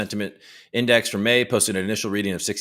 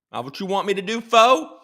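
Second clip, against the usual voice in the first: higher, louder playful taunt; mock taunt; 12 semitones higher, 5 dB louder than usual. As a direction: end the joke dare with a loud jab. Mock challenge ends.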